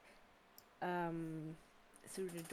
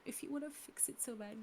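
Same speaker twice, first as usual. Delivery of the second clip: ordinary talk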